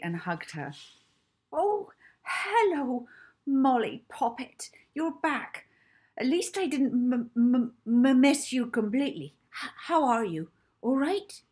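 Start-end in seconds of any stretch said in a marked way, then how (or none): 1.5-11.4 high-pitched, stammering motherly cook; a plump, fussing woman tripping over her words; much higher, louder voice with a nervous stutter; stammering Mrs Trinklebury. Acted. Mrs Trinklebury greets Molly.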